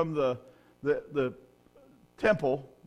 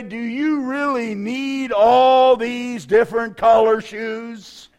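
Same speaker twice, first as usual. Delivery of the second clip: slow, higher, put-on husband voice, louder; mock husband; 7 semitones higher, 10 dB louder, much slower than usual. Husband teasing wife about her shoes, slow mock-exasperated voice. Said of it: "he'll look at you and say".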